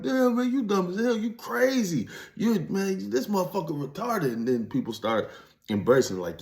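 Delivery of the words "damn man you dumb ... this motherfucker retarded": high, teasing fake praise that's really an insult; mocking onlooker. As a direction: imitate the people laughing at you, pitched way up. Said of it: "they be like".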